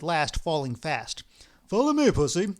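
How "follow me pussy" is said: firm, louder order to his partner; commanding order. Acted. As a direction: give the order briskly, louder. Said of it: "ordered Ostap".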